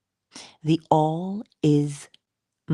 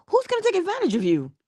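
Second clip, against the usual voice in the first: incredulous retort; faster than usual. Retold or imitated. Teacher retorts, voices pupil.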